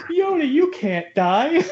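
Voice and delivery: high, slow, hammed-up plea; a mock Luke wailing the line; cheesy overacted line. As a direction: overact it like bad eighties dialogue. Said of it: "bad dialogue delivered in the worst way possible".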